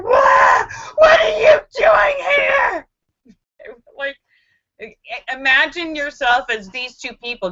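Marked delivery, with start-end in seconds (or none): 0.0-2.9 high, loud, slow frantic shout; panicked yell. Retold or imitated. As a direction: scream at the intruders in panic. Reenacting scream at strangers.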